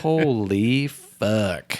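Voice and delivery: very slow, drawn-out disbelief; thinking to himself after being snapped at; stunned inner reaction. Retold or imitated. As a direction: let the shock sink in word by word. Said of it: "And I thought".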